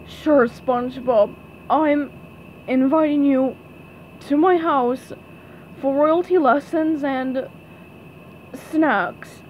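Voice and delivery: halting, forced invitation with gaps between phrases; reluctant. Acted. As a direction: squeeze it out bit by bit, reluctantly. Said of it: "Squidward swallowed hard".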